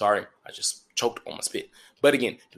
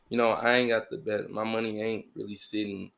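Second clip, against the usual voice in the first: ordinary talk, nothing marked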